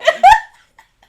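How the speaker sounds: high shrieking laugh. Real, not acted. Cracking up.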